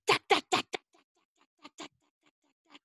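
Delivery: hard unvoiced "dak" bursts; jackhammer. Shown as a demonstration. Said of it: "hands over my ears".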